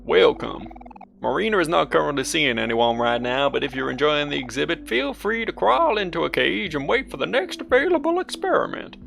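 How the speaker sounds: put-on lightly drawled Texan accent; classic Texan voice. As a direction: read it like a Texan host. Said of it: "a classic Texan voice".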